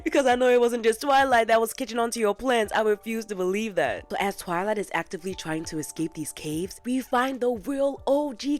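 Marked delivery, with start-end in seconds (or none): 0.0-2.7 loud, high-pitched demand; indignant, pushed-up questioning; mock interrogation. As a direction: grill the villain at full volume. Mock interrogation of evil Cadance.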